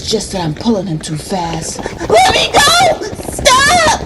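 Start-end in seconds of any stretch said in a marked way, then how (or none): 2.2-4.0 very high, loud screaming protest; child's yell. A narrator's reading. Little brother dragged along the ground, yelling. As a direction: scream it like a small boy being dragged. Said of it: "he yells".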